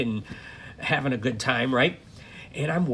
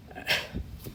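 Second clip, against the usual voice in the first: tired sigh; 6 dB quieter than usual. Real, not acted. Waking from an annoying dream.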